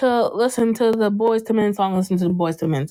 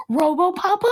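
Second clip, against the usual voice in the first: high-pitched mock disbelief; incredulous retold question; 8 semitones higher than usual. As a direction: ask it as an amazed, imitated question. Retelling a character's surprised question.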